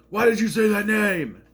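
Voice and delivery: mock anguished cry, high and loud; Heavy Superman impression. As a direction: yell it like a tormented hero. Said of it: "I'm like".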